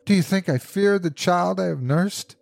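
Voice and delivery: high, defiant outburst; impassioned cry. A narrator's reading. Mother dragging husband to the door, impassioned. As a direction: challenge him with a mother's passion. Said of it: "cried the old woman".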